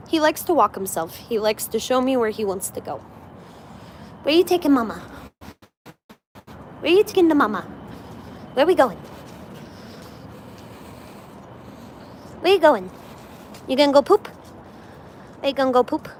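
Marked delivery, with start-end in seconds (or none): 4.2-16.1 lightly raised, sing-song pet-talk. Real, not acted. Baby talk to dog on walk.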